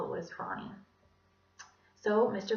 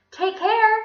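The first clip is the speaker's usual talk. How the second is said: put-on high, loud kid voice; piping imitated child farewell; Calvin; 14 semitones higher, 14 dB louder than usual. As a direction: chirp a goodbye as a schoolchild. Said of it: "said Calvin's voice".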